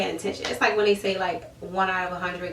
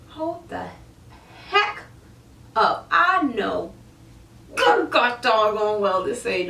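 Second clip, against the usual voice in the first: dawning alarm; slower than usual. Retold or imitated. Sudden realization quote.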